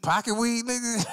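high, disbelieving echo; mock incredulous. Customer balks at the dealer's pocket weed, mock incredulous. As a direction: throw it back high in disbelief.

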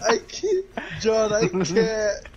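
slow words squeezed out through laughter; can barely speak for laughing; wheezing real laughter; laughing helplessly. Real, not acted. Cracking up at teammate.

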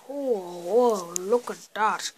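usual speaking voice

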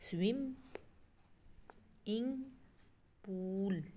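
स्विम and इंग high, rising, each alone; syllable by syllable. Shown as a demonstration. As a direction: chop स्विम, इंग, पूल apart with pauses. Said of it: "इसके three syllables हैं".

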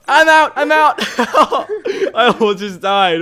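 laughing hard, high and loud; laughing panic. Real, not acted. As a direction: blurt it out through real laughter. Near death in game, laughing.

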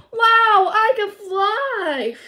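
high, loud, drawn-out pretend thrill; exhilarated. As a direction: play a pup soaring for the first time. Marshall tries the jetpack.

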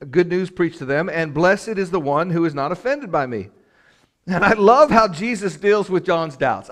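sermon voice lifted with conviction; emphatic preaching. Real, not acted. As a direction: proclaim it from the pulpit. Preaches scripture with force.

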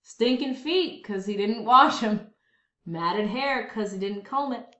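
usual speaking voice

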